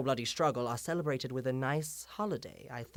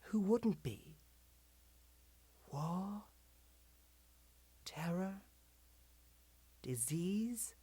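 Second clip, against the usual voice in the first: soft, spaced-out words with long pauses; hushed understanding; 4 semitones higher, 4 dB quieter than usual. Acted. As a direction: quietly concede the listeners' fears. Sympathizing with the listeners' fear.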